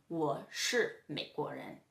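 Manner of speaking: slow, low Mandarin example; slow model sentence. Shown as a demonstration. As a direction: say it slowly for learners. Teacher models a Chinese example sentence.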